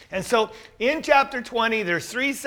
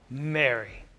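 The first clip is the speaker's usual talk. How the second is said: soft, lower, gentle naming; tender name call; 7 semitones lower, 8 dB quieter than usual. Retold or imitated. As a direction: say her name softly, like a loved one. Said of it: "maybe it's the way he said it".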